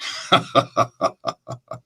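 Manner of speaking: soft, breathy evil cackle; sneering villain's laugh; taunting emperor's laughter; Shao Kahn laugh. Shown as a demonstration. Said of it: "the ultimate taunt is".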